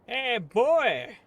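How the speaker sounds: put-on a loud, high hail from an old geezer; old man calling out. Old man shouting to the young man he met last night. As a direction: bark it across the street at the kid.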